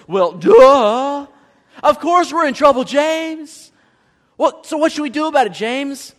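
hammed-up Well, duh, loud and high; mock sarcastic duh. Preacher mimicking the church's sarcastic reply. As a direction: roll your eyes on duh. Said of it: "And they're like".

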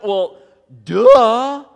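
hammed-up Well, duh, loud and high; mock sarcastic duh. Preacher mimicking the church's sarcastic reply. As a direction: roll your eyes on duh. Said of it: "And they're like".

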